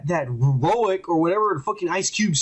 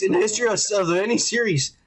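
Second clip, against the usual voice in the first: ordinary delivery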